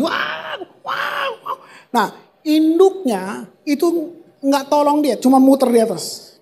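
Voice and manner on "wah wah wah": preacher mimicking the terrified eaglet's high cries; crying eaglet. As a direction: screech like a panicking baby eagle. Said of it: "anaknya teriak ini".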